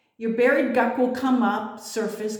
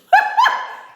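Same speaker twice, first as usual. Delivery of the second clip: sudden loud high laugh; laughing hard at her own warning; 7 dB louder than usual. Real, not acted. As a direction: burst out laughing.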